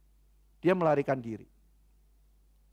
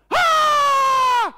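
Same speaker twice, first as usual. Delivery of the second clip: very high, loud sustained scream; acted scream; 11 dB louder than usual. Scolded child screams to stop papa, piercing acted scream. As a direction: cut loose with a frightened child's shriek. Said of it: "terus dia teriak".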